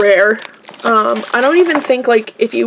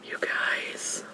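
whispering; 20 dB quieter than usual. Real, not acted. Finding rare toy, hushed aside.

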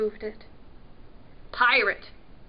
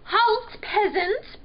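high, loud royal command; haughty order; 9 semitones higher, 4 dB louder, slower than usual. A narrator's reading. Prince challenging a trespassing peasant, ordering. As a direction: halt them like an imperious prince. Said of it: "Prince ordered".

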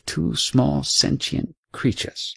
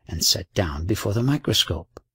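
ordinary delivery, nothing marked

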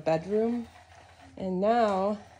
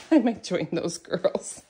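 laughing through the words; 4 dB louder than usual. Real, not acted. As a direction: chuckle your way through the line. Repeats line while laughing.

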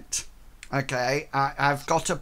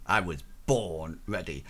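low, gruff character voice; deep tough voice; 10 semitones lower, 6 dB quieter than usual. Pretend. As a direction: growl it like a hero.

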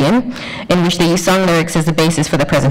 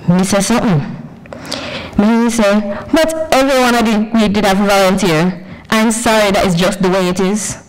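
sassy Jamaican patois; 4 semitones higher than usual. Retold or imitated. Inner voice talks back in patois.

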